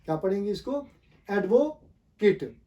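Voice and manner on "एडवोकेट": एडवोकेट slowly split as "ad-vo... kit"; slow teaching model of एडवोकेट, "AD-vo-kit"; noun pronunciation. Shown as a demonstration. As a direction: say एडवोकेट slowly with a short i at the end. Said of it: "noun के तौर पे".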